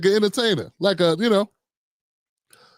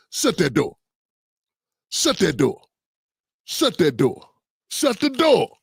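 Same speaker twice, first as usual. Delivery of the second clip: imitating bass voice. Commentator imitating the pastor's bass-voiced door order. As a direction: bark it like a gruff boss with bass. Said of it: "That's how you said it".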